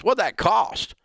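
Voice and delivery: put-on high incredulous old grandfather; grandfather's voice. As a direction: ask it like a baffled old Southern granddad, voice jumping up. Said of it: "And he goes".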